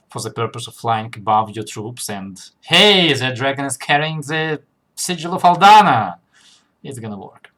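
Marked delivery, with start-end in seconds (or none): 2.7-6.2 calling out. Pretend. Calling out from dragon above troops.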